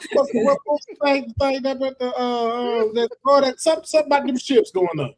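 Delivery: high, halting stammer, words tripping; stuttering uncle. Retold or imitated. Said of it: "dad sound just like that 'cause he stutters".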